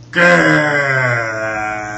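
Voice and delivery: loud monster growl. Acted. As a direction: growl like a scary cat.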